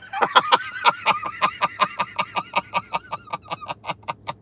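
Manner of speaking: high, wheezy run of laughter that slows; long laughing fit. Real, not acted. Streamer joking about the girlfriend's age, long laughing fit. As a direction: laugh helplessly till it peters out.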